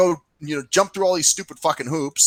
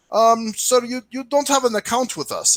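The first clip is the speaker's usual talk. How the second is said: high-pitched mock customer-service voice; imitated service rep; 9 semitones higher than usual. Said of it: "she goes".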